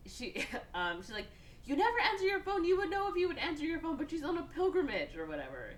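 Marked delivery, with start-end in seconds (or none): 1.6-5.2 fast, high-pitched telling-off; imitated scolding. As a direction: rattle it off like a fed-up sister. Sister scolds brother.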